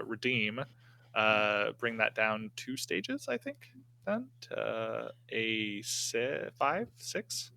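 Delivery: ordinary talk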